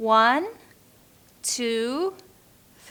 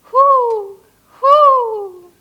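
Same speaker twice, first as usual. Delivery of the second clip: high, loud hoo; hooting owl call; 10 semitones higher, 10 dB louder than usual. Shown as a demonstration. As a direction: hoot like an owl. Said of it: "Friend Owl".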